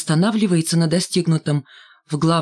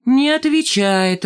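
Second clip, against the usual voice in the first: disappointed; 8 semitones higher, slower than usual. A narrator's reading. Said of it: "разочарованно сказал Валька".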